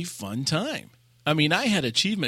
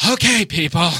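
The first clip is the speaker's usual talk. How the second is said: louder, higher effeminate call to the raid; drama queen; 4 semitones higher, 7 dB louder than usual. Retold or imitated. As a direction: round up the raid like a fussy diva. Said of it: "total G I Joe voice now guy".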